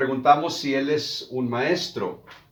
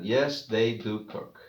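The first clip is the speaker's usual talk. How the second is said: slow model answer; slower than usual. Shown as a demonstration.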